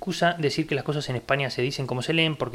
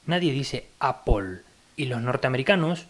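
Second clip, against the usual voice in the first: ordinary talk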